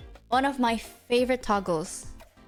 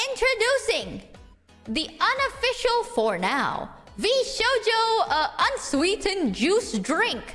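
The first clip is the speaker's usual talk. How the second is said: ad announcer; 6 semitones higher than usual. Pretend. Mock product announcement.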